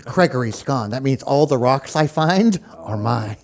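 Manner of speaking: low cartoon thinking voice; inner monologue. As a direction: voice it low, as a thought in his head. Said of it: "in his head, like the head".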